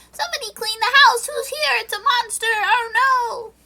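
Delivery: high, loud mock panic from little men; panicky dwarves. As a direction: squeal it like frantic dwarves. Dwarves panicking over intruder.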